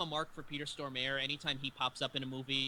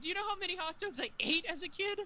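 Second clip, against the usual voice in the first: very high, hammed-up dismay; imitating horrified realization. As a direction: squeal it as comic horror dawns. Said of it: "he's just sitting there horrified".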